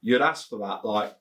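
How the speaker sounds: ordinary delivery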